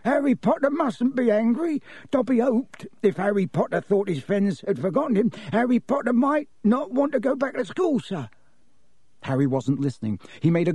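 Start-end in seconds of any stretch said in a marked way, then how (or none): 0.0-8.3 very high, anxious elf voice; high-pitched, nervous elf explaining itself; anxious house-elf. Acted. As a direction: plead anxiously as a tiny creature. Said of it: "Dobby blinked anxiously up at Harry".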